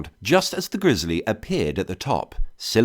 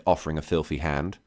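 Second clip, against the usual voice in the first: ordinary delivery, nothing marked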